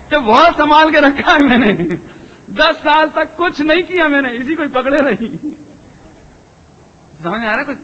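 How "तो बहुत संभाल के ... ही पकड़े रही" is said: a hint of a proud, laughing wife, pitched up; lightly voiced mother boasting through a laugh; mock-proud mother showing off how carefully she kept the child; boastful mother. Wife boasts of her care.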